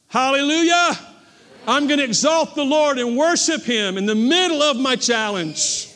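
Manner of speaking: high, loud, building to a shout; full-force preaching. Real, not acted.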